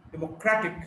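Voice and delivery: democratic as demo-CRAT-ic; democratic, weight on the third syllable; shifting-stress demo. Shown as a demonstration. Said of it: "stress shifts depending on the morphological structure".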